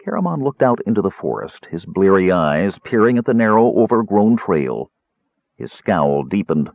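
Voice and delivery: ordinary delivery, nothing marked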